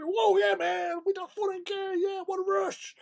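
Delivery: imitating excited Americans still cheering; hyped American whooping. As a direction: rave about the rush like a pumped-up dude. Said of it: "they're still going at it. Going".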